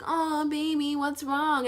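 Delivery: high-pitched cooing concern, imitated; mock sympathy. People fussing over someone crying, mock sympathy. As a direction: coo it like an over-sympathetic onlooker. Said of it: "sympathize you".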